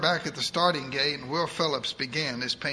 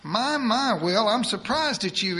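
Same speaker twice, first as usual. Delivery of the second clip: put-on higher, fast voice of a bossy old churchwoman; eager church lady; 6 semitones higher, 3 dB louder than usual. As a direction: rattle it off like a pushy saint. Edith teases Will.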